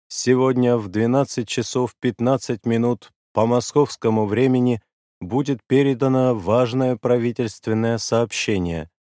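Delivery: громкий торжественный голос диктора радио; торжественно, медленно, громко объявить; голос Левитана. Acted. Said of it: "заговорил голосом диктора Левитана". (голос диктора Левитана)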